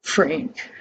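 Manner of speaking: ordinary delivery, nothing marked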